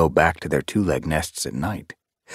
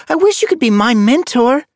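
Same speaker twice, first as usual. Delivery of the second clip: high, loud, eager wish; adoring youngster; 7 dB louder than usual. A narrator's reading. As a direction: say it like a starstruck child. Apprentice hero-worships new warrior.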